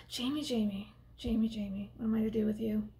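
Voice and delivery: soft, hushed fond exasperation; soft despairing sigh. Real, not acted. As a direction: murmur his name in despair. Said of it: "fond despair at character".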